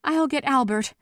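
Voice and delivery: high, urgent call. A narrator's reading. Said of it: "Bethany called".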